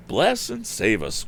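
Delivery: put-on high, slow alarmed old woman; crying housekeeper. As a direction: wail it like a frightened housekeeper. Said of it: "cried Missus Baggert".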